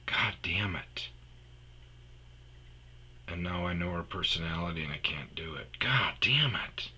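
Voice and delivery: low, breath-only muttering to yourself; whispered cursing. Real, not acted. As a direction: curse your luck under your breath. Cursing his luck to himself.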